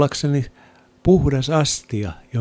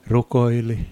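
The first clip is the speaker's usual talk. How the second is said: usual speaking voice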